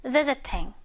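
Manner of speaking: "visiting" with the t fully sounded; British "visiting"; British pronunciation. Shown as a demonstration. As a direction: say a true t in "visiting", no flap. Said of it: "이렇게 발음을 할 거라는 거죠".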